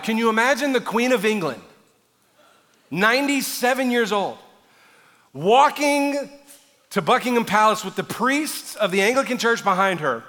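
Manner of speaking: high, loud, incredulous build; impassioned preaching. Acted. Preacher picturing the Queen of England in procession, rousing preaching. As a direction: punch each detail to the crowd.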